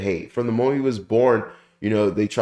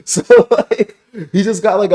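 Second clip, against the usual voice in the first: cracked up while carrying on talking; laughing; 10 semitones higher, 8 dB louder than usual. Real, not acted. Reviewer amused by the retold double shock, laughing. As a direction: talk through real laughter, high and loud. Laughs at his own impression.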